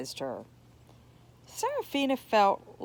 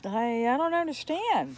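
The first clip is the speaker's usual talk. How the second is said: stammered; 4 semitones higher than usual. Acted. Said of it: "Serafina stammered".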